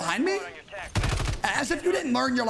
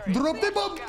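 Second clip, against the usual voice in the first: higher, punchy repeat; drop the bomb; 6 semitones higher than usual. Pretend. Taunting the enemy squad, quick high repeat. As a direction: snap it back up high.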